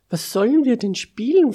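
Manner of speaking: high, loud, quick question; eager question. A narrator's reading. Eager character asks about a game. (neugierig, hoch und schnell fragen)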